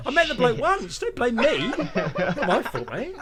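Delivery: mock protest. Imagined protest at the tabloids.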